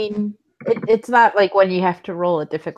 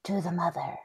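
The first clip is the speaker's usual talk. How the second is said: hushed, much softer invocation; whispered; 2 semitones lower, 11 dB quieter than usual. Acted. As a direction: whisper it softly like a secret invocation.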